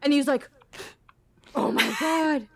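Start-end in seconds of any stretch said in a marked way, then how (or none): 1.5-2.5 higher-pitched mock panic; retold freak-out. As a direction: imitate someone freaking out. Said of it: "freaking out".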